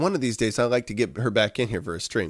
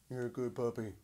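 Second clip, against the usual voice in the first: ordinary delivery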